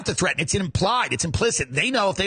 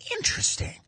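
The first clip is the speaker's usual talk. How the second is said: intrigued whisper; 5 dB quieter than usual. Acted. Intrigued reply in the gossip scene.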